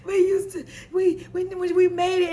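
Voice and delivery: high-pitched mock tearful pleading; mock crying. Co-host imitates crying character recalling shared songs, mock crying. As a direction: stammer through a put-on cry. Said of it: "when she cried".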